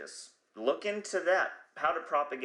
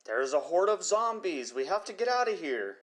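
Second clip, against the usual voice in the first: higher, hammed-up horror-movie alarm; mock zombie panic; 6 semitones higher than usual. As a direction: play a panicked survivor fleeing zombies, tongue in cheek. Mock movie line about zombies.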